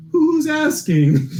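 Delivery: high, slow, suspicious clerk voice; wary desk clerk. Acted. Desk clerk steps back from the frantic woman, high and wary. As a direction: lean back and ask it warily. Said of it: "steps back and goes".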